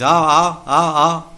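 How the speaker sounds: high, loud hooting calls to monkeys. Retold or imitated. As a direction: call the monkeys with sharp hoots. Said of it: "then calls the monkeys".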